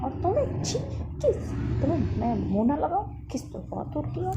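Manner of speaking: high, loud, revolted outburst; indignant, scornful refusal; acted disgust. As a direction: recoil at the very idea.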